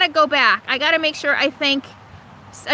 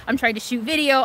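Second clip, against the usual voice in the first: ordinary talk, nothing marked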